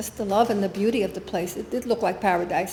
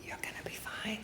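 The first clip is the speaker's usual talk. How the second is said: much softer whispered reassurance; 17 dB quieter than usual. Retold or imitated. Swami tilting his head, whispering reassurance. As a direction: breathe it, barely a whisper.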